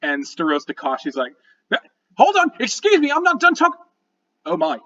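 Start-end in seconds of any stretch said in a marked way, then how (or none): 2.2-4.8 high, loud interruption; high-pitched, indignant little gnome; flustered gnome leader. Acted. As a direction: cut in urgently, high and fussy.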